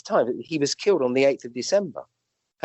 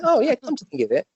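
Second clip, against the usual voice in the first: high, lightly voiced patient catching on; mock patient realising; 11 semitones higher than usual. Doctor imitating a patient realising, in a higher voice. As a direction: play the patient suddenly remembering.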